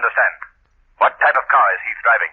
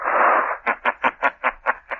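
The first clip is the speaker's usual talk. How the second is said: eerie laugh; 5 semitones higher, 4 dB quieter than usual. Acted. The Shadow's mocking laugh.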